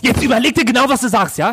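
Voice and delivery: high, loud furious warning; angry shout. Acted. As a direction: yell a threat at your partner. Couple quarrel escalates.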